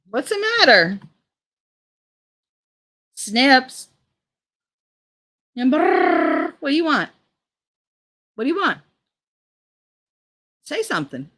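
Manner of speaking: high, loud pet-talk; talking to the cat. Real, not acted. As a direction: coo up at the cat. Talking to her meowing cat.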